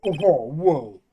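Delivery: sudden loud startled whoa. Real, not acted. Gamer startled by a throat clear, loud whoa. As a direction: jolt back in surprise.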